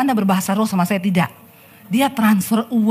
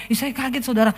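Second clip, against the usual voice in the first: normal talking voice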